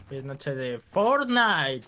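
slow, drawn-out announcement; slow announcer drawl. Acted. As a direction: stretch every word out. Echoing slogan slowly.

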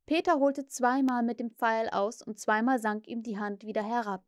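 usual speaking voice

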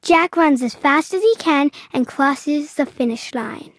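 ordinary delivery, nothing marked

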